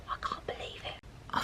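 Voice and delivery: breathless whisper of disbelief; real hushed whisper to the camera. Real, not acted. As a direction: whisper so the bird stays put. Whispering near a wild kingfisher.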